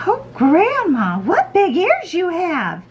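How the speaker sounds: put-on high, slow, marvelling little girl. A narrator's reading. Red notices Grandma's large ears, high girlish wonder.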